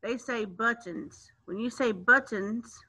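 ordinary talk

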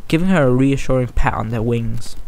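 plain reading voice

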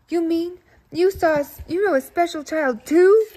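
eager excited outburst; excited interruption. Acted. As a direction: blurt it out in amazement. Both interrupt Gabby excitedly.

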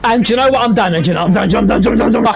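high, gabbled catchphrase on a loop; frantic repetition. Real, not acted. Gamer fed up with the game, frantic repetition. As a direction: stammer it out over and over, exasperated. Repeats catchphrase in rising exasperation.

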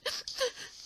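high breathy crying sob; a weeping old tree sobs; acted sob. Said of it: "lloró desconsoladamente".

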